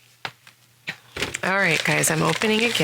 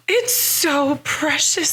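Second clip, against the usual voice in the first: high, slow, gushing squeal; melting over something adorable; overwhelmed by cuteness; 9 semitones higher than usual. Real, not acted. Gushing over a tiny ornament.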